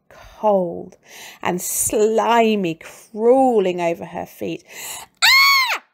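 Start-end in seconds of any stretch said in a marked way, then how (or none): 5.2-5.8 scream. A narrator's reading. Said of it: "she screamed".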